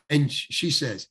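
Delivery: ordinary delivery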